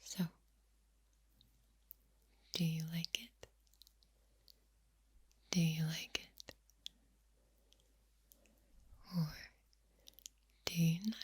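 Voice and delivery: gentle unvoiced ASMR whisper; soft spoken. Shown as a demonstration. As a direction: hush it, soothing and close. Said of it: "my soft-spoken voice".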